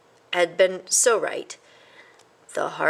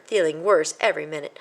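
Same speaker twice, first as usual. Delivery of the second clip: ordinary delivery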